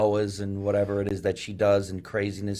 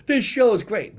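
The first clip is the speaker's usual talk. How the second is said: happy audience; 12 semitones higher, 6 dB louder than usual. Retold or imitated. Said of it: "watch hundreds of people go".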